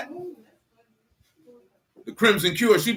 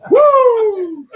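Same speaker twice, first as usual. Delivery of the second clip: high, loud laughing whoop; cheering the joke; laughing woo; 7 dB louder than usual. Real, not acted. Laughing celebration.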